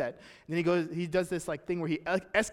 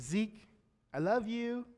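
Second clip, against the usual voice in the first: higher and softer, gentle shush; tender mother soothing; 5 semitones higher, 4 dB quieter, slower than usual. Retold or imitated. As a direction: soothe a crying baby as his mom. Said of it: "comes up to Zeke and says".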